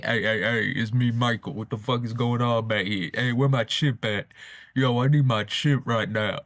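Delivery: a mild put-on streetwise voice; casual and slangy, not his public one; joking guess at how the star talked offstage; Michael's imagined real voice.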